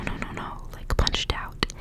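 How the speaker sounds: ordinary talk, nothing marked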